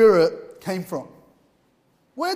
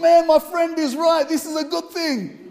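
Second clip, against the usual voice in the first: high-pitched, amazed retelling; lifted voice, imitating happy amazement; joyful realization; 5 semitones higher than usual. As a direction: act out a man overjoyed by his discovery. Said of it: "He was so filled with joy".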